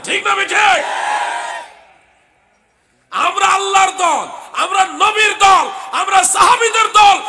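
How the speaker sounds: slow, hammering shouted preaching; full-force sermon shout; fiery shouting. Real, not acted. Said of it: "জোরে কথা হয়ে যায়".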